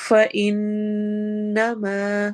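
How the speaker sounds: فَإِنَّمَا slow, the noon nasalised and held; slow chanted ghunnah in فَإِنَّمَا. Shown as a demonstration. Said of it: "ان میں نون غنہ ہوگا".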